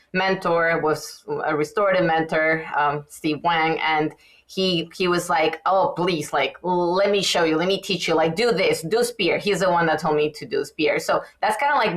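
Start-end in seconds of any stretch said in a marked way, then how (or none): none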